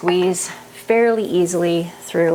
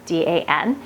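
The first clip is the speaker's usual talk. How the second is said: ordinary talk, nothing marked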